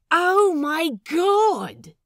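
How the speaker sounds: high, loud, drawn-out "Oh my God"; exasperated outburst. Acted. Lucius groaning at the crew's logic, exasperated. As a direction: throw up your hands.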